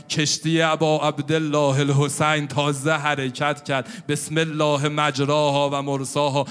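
loud impassioned preaching; preaching at full force. Acted. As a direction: call out to the people with fervour. (منبر پرشور با صدای بلند)

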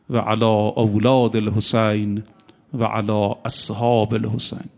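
slow, low devotional prayer recitation; ziyarat recitation. Acted. A preacher reciting the ziyarat to mourners, slow and reverent. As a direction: intone the salutation reverently.

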